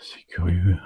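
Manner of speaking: soft curious whisper. Real, not acted. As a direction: whisper it with quiet wonder. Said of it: "Pourquoi je parle tout bas".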